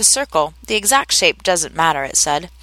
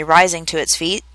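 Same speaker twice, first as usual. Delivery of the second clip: ordinary delivery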